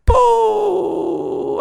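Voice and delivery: very high, loud mock booing; shrieking boos, hammed up and loud; angry booing. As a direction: boo the new queen like an angry crowd. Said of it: "I touch the mic 'cause I'm angry".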